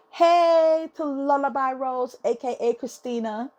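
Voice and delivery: high, slow, drawn-out hey; shout-out. Real, not acted. Giving a shout-out to the poet Lullaby Rose, high and drawn out. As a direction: call her name out high and slow.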